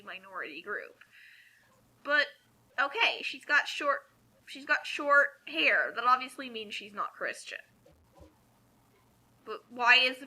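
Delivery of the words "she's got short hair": usual speaking voice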